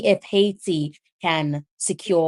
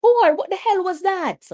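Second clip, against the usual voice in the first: high, loud what-the-hell cry; shocked disbelief; 11 semitones higher, 3 dB louder than usual. Real, not acted. Commentator shocked by a terrible play, crying out high. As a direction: blurt it out, stunned.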